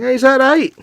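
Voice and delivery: high, loud challenge; mock-aggressive shout at an opponent; intimidating Scot. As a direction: bellow it like a fierce young Scot. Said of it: "beat their opponents just by saying".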